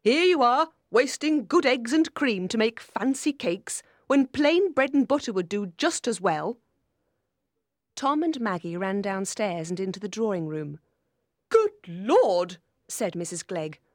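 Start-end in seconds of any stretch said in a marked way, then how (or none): 0.0-6.6 higher, louder fault-finding aunt; indignant, carping character voice; scolding aunt. A narrator's reading. 11.5-12.6 loud indignant exclamation; loud, stern reproach; shocked disapproval. A narrator's reading. Aunt scolds noisy children.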